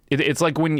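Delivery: normal talking voice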